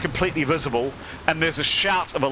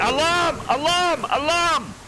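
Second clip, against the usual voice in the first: screaming alarm; 14 semitones higher, slower than usual. Acted. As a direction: raise the alarm at the top of your lungs. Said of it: "one's screaming".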